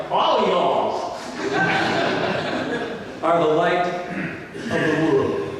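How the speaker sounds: laughing, slow Texan "All y'all"; hammed-up Texan with a chuckle. Shown as a demonstration. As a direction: stretch it out Texan-style through a laugh. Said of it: "So it's like".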